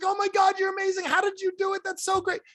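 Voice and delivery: very high-pitched, hammed-up amazement; excited gushing. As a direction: squeal with delight like a thrilled customer. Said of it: "Now he's excited".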